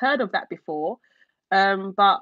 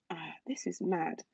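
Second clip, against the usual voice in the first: breathless whisper of disbelief; whispered amazement; 4 semitones lower, 13 dB quieter than usual. Real, not acted. Streamer whispering to herself over a super chat. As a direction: whisper it, stunned by the surprise.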